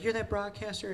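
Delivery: surprised recognition. Retold or imitated. Mother realizing she is meeting that broadcaster, surprised.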